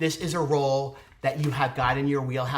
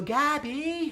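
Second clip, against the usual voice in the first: high, drawn-out call; 12 semitones higher than usual. Pretend. Giving a special shout out to a friend, high calling voice. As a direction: sing out the friend's name, pitched way up.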